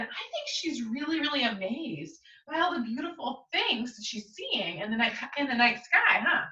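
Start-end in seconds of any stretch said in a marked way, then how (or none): none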